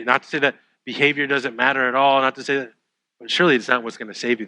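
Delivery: ordinary talk, nothing marked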